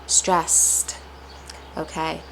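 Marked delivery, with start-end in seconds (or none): 0.0-1.0 "Stressed" with drawn, emphasized s sounds; isolated demo word, S's stressed. Shown as a demonstration. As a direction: lean into the hissing S's of "Stressed". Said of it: "stress placed on the S S".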